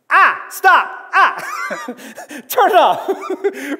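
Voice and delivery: high, loud mock cry against the light, laughing; pained squint yelp. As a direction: yelp as if a light blinds you. Someone suddenly turns on light.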